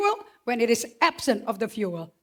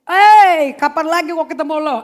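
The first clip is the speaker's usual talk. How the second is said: loud, high excited greeting; a loud, eager hello to the wife; excited shout; 6 semitones higher, 16 dB louder than usual. Pretend. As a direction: call out eagerly to your spouse. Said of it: "kalau gua sih".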